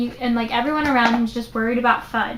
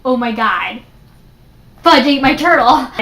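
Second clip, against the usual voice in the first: put-on a boy finding out his pet is gone, loud; panicked shock; 7 dB louder than usual. Peter's shock at lost turtle.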